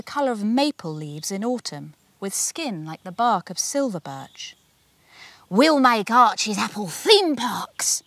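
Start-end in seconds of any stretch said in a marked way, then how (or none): 5.5-8.0 loud, slow bellow; bellowing tycoon. A narrator's reading. Tarquin bellows his plans in the kitchen, loud and slow. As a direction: bellow it out grandly.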